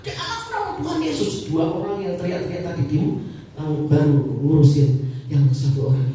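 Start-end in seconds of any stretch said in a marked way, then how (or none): 0.0-1.3 high-pitched command in prayer; re-enacted commanding prayer. Preacher prays over everyone to stop, high-pitched command. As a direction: halt them with a raised-pitch prayer. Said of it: "Akhirnya saya berdoa".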